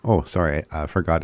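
usual speaking voice

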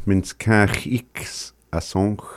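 each syllable of "Minskach X Asonch" spoken slowly and carefully; careful slow teaching repeat; slow repeat-after-me. Shown as a demonstration. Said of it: "repeats after the recording".

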